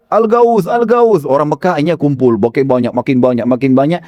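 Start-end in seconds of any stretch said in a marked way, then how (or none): 0.1-1.3 higher mock cry for help; imitated continued shouting. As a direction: keep calling the alarm, not full force. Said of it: "dia terus teriak". (tiruan teriakan bernada lebih tinggi)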